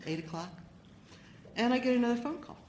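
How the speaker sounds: ordinary delivery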